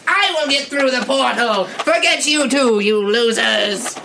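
high, gloating evil cat yell; triumphant villain shout. Acted. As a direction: crow over the losers you leave behind. Catman escapes through the portal.

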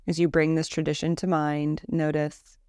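calm, measured Yoga Nidra guidance with long pauses; guided meditation. Acted. Teacher leading a listener through a Yoga Nidra body scan, guided meditation voice. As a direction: lead them gently into rest, leaving space between phrases.

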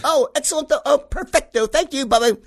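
very high, gushing mock-British pleasantries; posh British phone chat. Father charming the caller in a fake British accent. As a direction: purr through the call like an aristocrat. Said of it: "he'd get this Brit".

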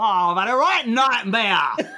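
put-on high, slow Cockney toddler voice; child's Cockney voice. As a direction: imitate a little girl's Cockney accent. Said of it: "She'd go".

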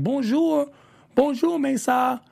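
a hammed-up French charmer greeting. Jacques greeting Mesa in a mock French accent. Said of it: "comes in and is like".

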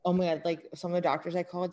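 ordinary talk

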